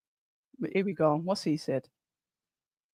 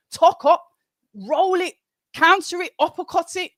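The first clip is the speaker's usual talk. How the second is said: higher, louder ringside calls; excited calling out; 9 semitones higher, 4 dB louder than usual. Real, not acted. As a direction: urge the fighter on, move by move. Calling moves to fighter.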